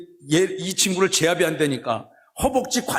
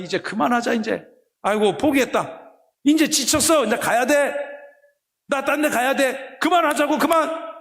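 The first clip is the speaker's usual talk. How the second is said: weary plea to stop; 6 semitones higher than usual. Acted. Wrestling stranger begs to stop.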